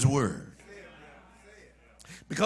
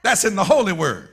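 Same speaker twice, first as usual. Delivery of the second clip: louder fervent preaching; 4 semitones higher, 5 dB louder than usual. Acted. Preacher citing Jeremiah, Elijah and Moses, fervent preaching. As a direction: press each holy word home.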